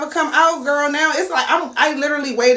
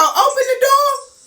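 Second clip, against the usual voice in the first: very high, loud bark; shouted order; 13 semitones higher, 4 dB louder than usual. Retold or imitated. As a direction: yell it through the door. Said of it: "She go".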